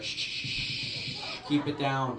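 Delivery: long, slow hissed shushes; shushing librarian. Retold or imitated. Old man to noisy moviegoer, librarian-style shushing. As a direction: shush them slowly like a librarian. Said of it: "I was like a librarian".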